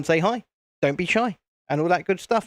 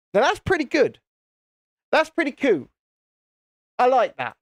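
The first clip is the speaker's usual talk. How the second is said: a put-on Irish lilt, pitched up; Irish accent; 7 semitones higher than usual. Streamer showing off the subscriber count to chat in a mock Irish accent. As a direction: play up a jokey Irish accent. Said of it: "My, my Irish accent".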